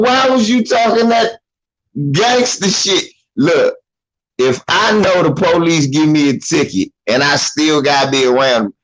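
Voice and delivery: normal talking voice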